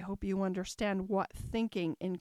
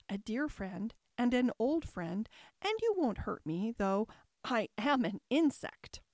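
plain reading voice

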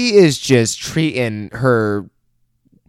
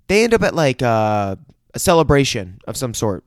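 ordinary talk, nothing marked

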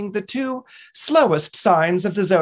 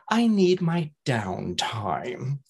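slow Cancer sigh; 4 semitones lower, 4 dB quieter, much slower than usual. Retold or imitated. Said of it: "Cancers might respond with".

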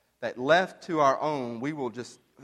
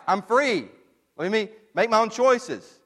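louder, higher, defiant cry of "it's my life"; defiant outburst; 6 semitones higher, 6 dB louder than usual. Acted. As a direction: shout it like a rebel demanding freedom. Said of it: "who are you to say".

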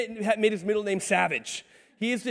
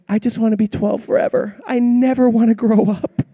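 louder, imitated little-girl pleading; daughter's cutesy voice; 3 semitones higher, 7 dB louder than usual. Girl telling dad she'll stay twelve, cutesy imitation. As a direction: put on an adorable child's voice. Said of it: "my daughter talks like this".

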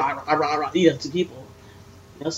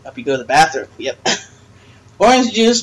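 usual speaking voice

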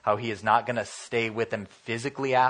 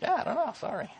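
high, sheepish shrug of a reply; mock pastor apologizing, pitched up and soft at the end; lightly imitated awkward pastor; sheepish apology; 13 semitones higher, 3 dB quieter than usual.

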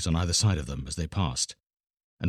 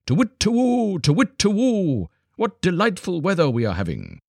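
very high, loud owl hoots; hooting owls calling out; 11 semitones higher, 3 dB louder than usual. A narrator's reading. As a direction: hoot across the forest, delighted. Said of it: "called out to each other across the forest".

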